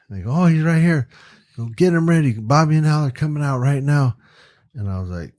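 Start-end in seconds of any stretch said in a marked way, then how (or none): 0.3-4.2 lightly voiced higher, urgent crew; crew member calling out. Staff rounding up the camera guy for Bobby and Al, calling out. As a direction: call it out like an on-set assistant.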